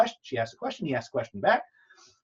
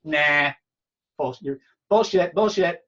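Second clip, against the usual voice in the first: louder mock disbelief, scoffing; disbelieving; 4 semitones higher, 8 dB louder than usual. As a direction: scoff it off loudly, not buying it. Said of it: "She goes".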